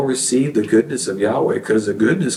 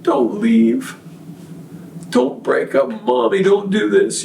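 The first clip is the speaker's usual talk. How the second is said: higher, pleading little boy's voice, lightly voiced; imitating a crying little boy; 8 semitones higher than usual. Father voices his crying son begging Mommy, a light imitation. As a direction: echo the small son begging his parents not to split. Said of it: "he sat in his room and cried".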